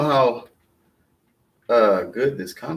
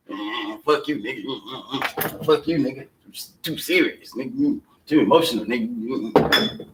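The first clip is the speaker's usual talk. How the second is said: dismissive brush-off; 2 semitones lower, 5 dB quieter than usual. Acted. Dancer waving away the too-serious warner, dismissive. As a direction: softly brush him off between grunts.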